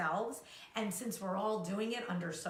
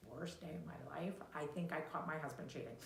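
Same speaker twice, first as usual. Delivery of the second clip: much softer, fast aside; muttered truth; 4 semitones lower, 9 dB quieter, faster than usual. Retold or imitated. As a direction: mutter the truth under the breath. Inner truth behind the lie.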